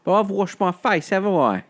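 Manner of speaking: put-on high Cockney girl voice; Eliza voice. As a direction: play the indignant flower girl. Quoting Eliza from the film.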